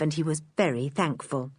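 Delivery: usual speaking voice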